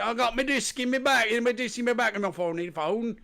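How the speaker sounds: high mock British mumble; a mock British accent, hard to follow. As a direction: send up his British accent. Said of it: "have an accent".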